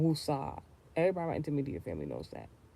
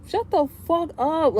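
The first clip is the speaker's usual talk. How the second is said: high, slow, loud outburst; high loud shout; 12 semitones higher, 9 dB louder, slower than usual. Real, not acted. Telling the nosy woman off, high loud shout. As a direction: snap it at her. Furious retort.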